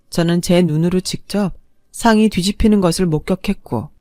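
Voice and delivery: usual speaking voice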